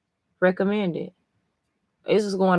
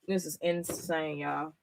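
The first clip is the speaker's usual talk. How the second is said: stunned quiet; 12 dB quieter than usual. Real, not acted.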